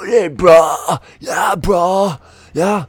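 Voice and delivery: loud, high, far-off bro yell; bro shout. Retold or imitated. Mocking day-drinking gym bros, loud bro yell.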